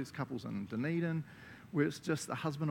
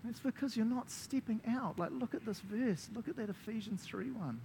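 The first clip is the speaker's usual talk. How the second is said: imagined God; 9 semitones higher, 3 dB quieter than usual. Acted. God to believers not stepping out, urgent pleading. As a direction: urge them on as an exasperated God. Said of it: "I imagine God just being like".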